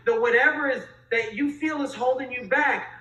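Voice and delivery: ordinary talk, nothing marked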